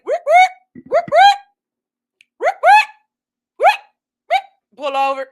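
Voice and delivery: short high police siren bursts; imitated siren whoops. Host imitating a police car driving by, siren whoops.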